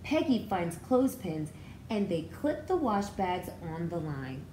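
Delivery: ordinary delivery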